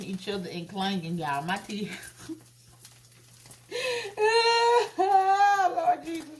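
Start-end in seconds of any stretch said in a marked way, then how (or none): 3.7-6.3 laughing fit. Real, not acted. Laughing at her own teeth.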